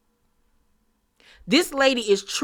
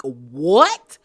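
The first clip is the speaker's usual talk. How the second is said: mock scream; much slower than usual. Retelling Uba's outburst, a mild mock yell. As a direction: imitate a shocked yell, not full force. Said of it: "scream at the top of her lungs".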